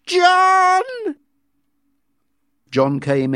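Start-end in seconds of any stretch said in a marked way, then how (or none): 0.0-0.8 loud high call. A narrator's reading. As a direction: call him in through the door. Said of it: "she called".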